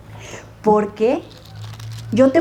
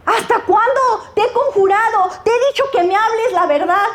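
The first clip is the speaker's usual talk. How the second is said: angry king; 7 semitones higher than usual. Retold or imitated. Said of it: "el rey Acab se enoja y dice".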